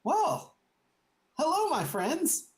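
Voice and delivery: a high smug welcome from the scheming councilman; high, loud, smarmy noble voice; oily councilman. Acted. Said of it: "And Samuel goes".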